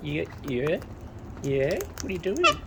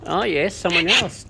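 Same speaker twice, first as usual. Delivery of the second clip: normal talking voice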